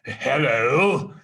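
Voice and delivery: a hint of the old grumpy Scrooge; feigned growl. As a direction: put on a pretend gruff growl. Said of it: "growled Scrooge in his accustomed voice".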